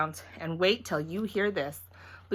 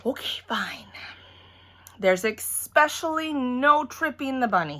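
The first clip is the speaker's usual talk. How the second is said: resigned whisper then giving in; grudging daddy; 6 semitones higher than usual. A narrator's reading. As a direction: give in with a sigh. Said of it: "The daddy sucked in his cheek".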